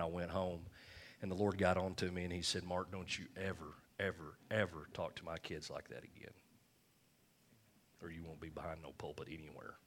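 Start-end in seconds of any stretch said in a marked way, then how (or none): 2.6-9.8 low, hushed stern warning, fading to a whisper; a grave rebuke, dropping to a whisper; the Lord's warning. Retold or imitated. The Lord rebukes the pastor.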